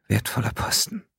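husky whisper on wertvoller Posten, rising; creaky whisper. Acted. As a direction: breathe wertvoller Posten hoarsely. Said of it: "heiseres Flüstern".

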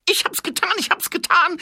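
frantic, very high-pitched shouting; desperate cry. A narrator's reading. Thief confessing to the watchman, desperate high shout. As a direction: cry it out loudly in a high put-on voice. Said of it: "rief der schuldige Schuft verzweifelt".

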